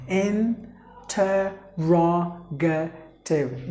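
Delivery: interrogative drawn out slowly, each syllable apart; teacher drawing out interrogative slowly; very slow model word. Shown as a demonstration. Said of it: "That's how you have to pronounce".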